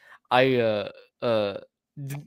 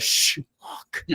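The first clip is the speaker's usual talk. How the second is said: unvoiced group hush, retold; hushed warning. Retelling the group's reply in the woods, hushed. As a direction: imitate everyone shushing in a whisper. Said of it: "everyone in synchronicity goes".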